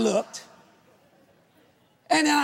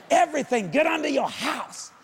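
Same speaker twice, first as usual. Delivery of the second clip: usual speaking voice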